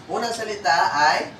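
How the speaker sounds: ordinary talk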